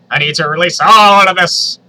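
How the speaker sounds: hammed-up yell of desperation; mock shout. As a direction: belt it out like a desperate cartoon character. Dipper's thought read out, shouted.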